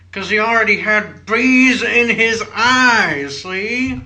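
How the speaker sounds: high, loud, slow manic outburst; Nicolas Cage rant. Pretend. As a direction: ham up an unhinged Nicolas Cage. Nicolas Cage bee scene parody.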